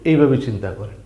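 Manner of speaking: usual speaking voice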